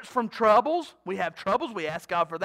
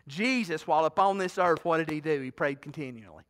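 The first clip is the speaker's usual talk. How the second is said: ordinary talk, nothing marked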